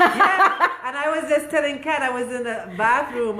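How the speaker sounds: giggling as she starts the story; laughing. Real, not acted. Laughing telling the bathroom story.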